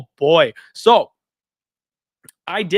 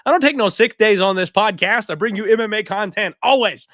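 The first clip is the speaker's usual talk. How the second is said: loud defiant; 6 semitones higher, 6 dB louder than usual. Real, not acted. Defiant boast about never skipping shows.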